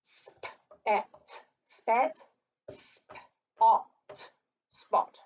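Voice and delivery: spat and spot compared slowly; sounding out words. Shown as a demonstration. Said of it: "They're gonna see what sound is different".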